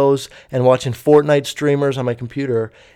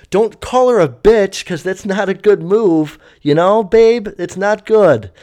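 higher and louder, telling her off; scolding rant; 5 semitones higher, 4 dB louder than usual. Acted. Narrator scolding the rude passenger, higher and louder. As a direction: scold her like she's right there.

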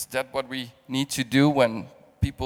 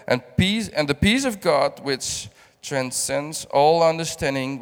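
louder, full-voiced reading of the passage; preacher reading Philippians aloud; preaching scripture; 6 dB louder than usual. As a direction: proclaim the verses to the room.

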